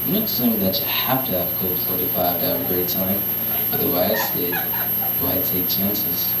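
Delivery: very low, softly spoken ad pitchman; Billy Dee Williams. Acted. Comic doing a celebrity beer ad, very low impersonation. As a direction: suave low impression of a TV spokesman. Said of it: "This is my impersonation of Billy Dee Williams".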